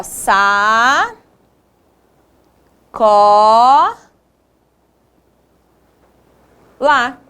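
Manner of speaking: each syllable drawn out, long pauses between; syllable-by-syllable dictation. Shown as a demonstration. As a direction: dictate slowly, one held syllable at a time. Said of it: "separamos essas palavras em sílabas".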